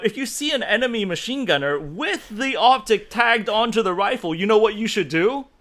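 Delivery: normal talking voice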